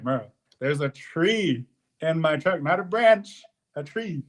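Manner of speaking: slow, rising to high on not a branch; exasperated insistence. Retold or imitated. Driver insisting to dispatcher it's a whole tree, exasperated. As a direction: make him get how big it is.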